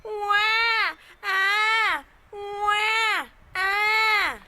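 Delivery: high, loud wailing wah; a hammed-up baby cry; mock baby crying. Shown as a demonstration. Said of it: "A baby crying".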